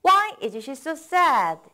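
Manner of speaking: slow, clear model sentence; slow model reading. Shown as a demonstration. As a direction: read it out slowly for learners to copy. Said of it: "오늘의 첫 번째 문장 보죠".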